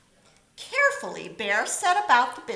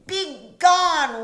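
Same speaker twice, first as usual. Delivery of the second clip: a loud, higher roar; 7 semitones higher, 12 dB louder than usual. A narrator's reading. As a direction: blast him out the door. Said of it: "roared the bear".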